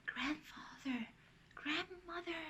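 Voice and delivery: soft, hushed call; whisper. A narrator's reading. Anna to her sleeping grandparents, whispering. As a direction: whisper to wake them gently. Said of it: "And Anna whispers".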